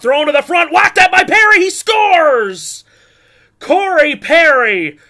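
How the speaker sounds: high, loud shout of a goal; goal call. Real, not acted. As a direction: yell that he scores.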